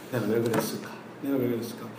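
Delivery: soft, muttered regret to yourself; guilty self-reproach. Retold or imitated. Father quietly regretting yelling at his crying daughter. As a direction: sigh it out as if you feel guilty. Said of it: "죄책감이 드는 거예요".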